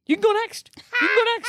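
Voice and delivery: high, defensive mock backing down; imitating a comic backing off with hands up; hands-up surrender voice. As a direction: throw your hands up and give in, way up high. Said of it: "he puts his hands up and he goes".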